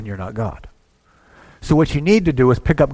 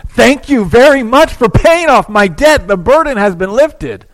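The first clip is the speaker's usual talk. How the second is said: loud, high, overjoyed thank-you; grateful thanks; 9 semitones higher, 8 dB louder than usual. Pretend. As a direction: pour out thanks like a freed debtor. Imagined grateful debtor thanks benefactor.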